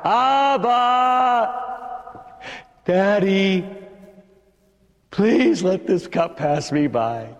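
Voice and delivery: high, drawn-out pleading cry. Acted. Preacher as Jesus crying out to his Father, anguished. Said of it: "he starts to cry out".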